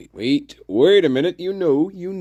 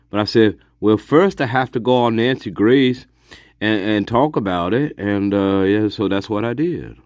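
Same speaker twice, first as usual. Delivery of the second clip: Chris McKenna impression; 7 semitones lower than usual. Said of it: "terrible Chris McKenna impression".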